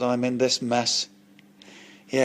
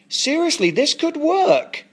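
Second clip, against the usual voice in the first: excited pitch; 7 semitones higher than usual. Acted. Martin persuades John it could work, excited and emphatic. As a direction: sell the idea with stretched-out, high-pitched conviction. Pitching a plan with growing conviction.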